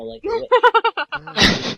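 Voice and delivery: high, slow cackling witch voice; witch cackle voice. Shown as a demonstration. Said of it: "Yeah, like that".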